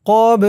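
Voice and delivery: ক্বাব with a bouncing release on the b; echoing bounce closing ক্বাব; qalqalah push. Shown as a demonstration. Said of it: "ধাক্কা দিয়ে পড়তে হবে".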